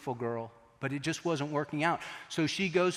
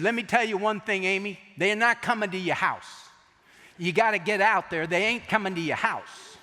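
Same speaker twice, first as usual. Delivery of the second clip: put-on New York accent; 7 semitones higher, 6 dB louder than usual. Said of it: "Gerald has this New York abs-, accent".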